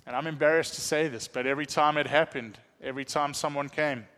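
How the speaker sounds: normal talking voice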